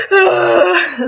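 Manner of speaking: high, loud squeamish "ah"; mock scared cry. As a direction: imitate recoiling at blood with a cry. Reacts to seeing someone's blood.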